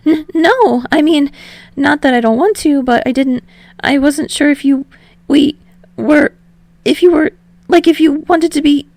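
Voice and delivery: high, loud, stumbling flustered rush; flustered ramble. Acted. Hinata blurting to Tsukishima, high flustered stammer. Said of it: "During his ramble".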